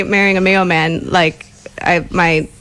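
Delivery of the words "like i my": normal talking voice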